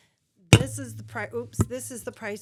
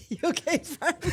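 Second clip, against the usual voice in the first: higher, laughing concern; 8 semitones higher than usual. Real, not acted. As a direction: ask it through laughter. Laughing after cough.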